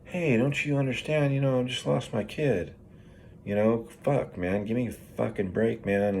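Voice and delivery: pleading inner protest. Retold or imitated. Man voicing his silent protest to his boss, pleading. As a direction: plead your case, easing down in pitch. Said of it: "what was going through my mind was".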